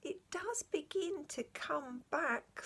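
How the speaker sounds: slow, soft, wondering; remembering something from long ago; thoughtful whisper. A narrator's reading. The horse slowly recalling his old life with the cabbie, thoughtful. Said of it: "said the horse thoughtfully".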